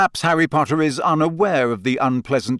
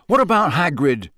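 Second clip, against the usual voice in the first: angrily; 5 semitones higher, 3 dB louder than usual. Acted. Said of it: "Harry said angrily".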